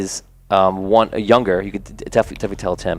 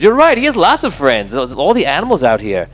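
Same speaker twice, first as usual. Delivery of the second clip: put-on higher, louder sarcastic teen jeer; sarcastic bully; 6 semitones higher, 4 dB louder than usual. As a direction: fake agreement dripping with mockery. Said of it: "He goes".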